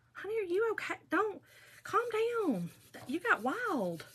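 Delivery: high-pitched, cautious check-in; mock concern. To a wild concertgoer, high mock-concerned calming. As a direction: playfully talk down an overexcited fan.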